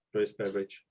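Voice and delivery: usual speaking voice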